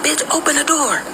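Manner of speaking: high mock yell of the angry man; angry boyfriend yell. Boyfriend pounding on her hotel door, mock yell. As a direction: bark it through the door like him.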